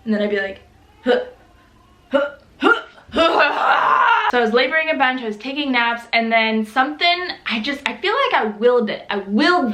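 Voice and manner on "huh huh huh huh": high breathy panting huffs; labor panting. Retold or imitated. Laboring mom panting through a contraction. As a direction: pant through the pain.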